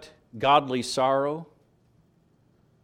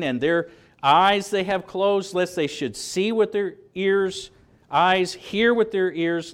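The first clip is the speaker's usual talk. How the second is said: booming scripture quote; 7 semitones higher, 6 dB louder than usual. Acted. Preacher booms the quoted prophecy.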